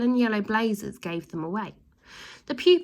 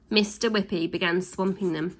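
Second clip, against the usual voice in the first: usual speaking voice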